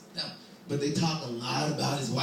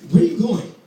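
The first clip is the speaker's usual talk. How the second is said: loud, higher demand; guard demanding; 9 semitones higher, 13 dB louder than usual. Acted. Guard questioning the boy, loud demand. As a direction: challenge him hard.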